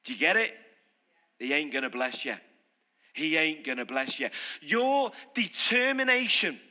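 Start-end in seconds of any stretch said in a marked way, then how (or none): 1.4-4.3 much lower, flat warning; grave low-pitched warning; low firm verdict. Real, not acted. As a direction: lay the verdict down heavily. Repeating the verdict.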